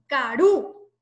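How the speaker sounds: "Karú" leaning hard on the ú; stress on final U. Shown as a demonstration. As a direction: make the U of "Karú" the strongest sound. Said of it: "En la U".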